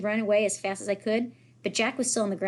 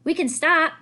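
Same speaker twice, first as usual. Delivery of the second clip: louder, higher call from ahead; yelled from afar; 8 semitones higher, 7 dB louder, slower than usual. A narrator's reading. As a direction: call back to the others. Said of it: "Miles yelled from farther up".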